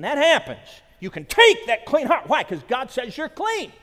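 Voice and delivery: loud, high, triumphant proclamation; full-force preaching. Acted. Preacher assures friends of cleansing, full-force preaching. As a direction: ring it out at full preacher's volume.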